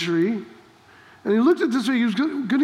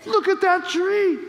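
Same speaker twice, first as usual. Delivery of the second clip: amazed Peter; 6 semitones higher, much slower than usual. Retold or imitated. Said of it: "Peter looks and goes".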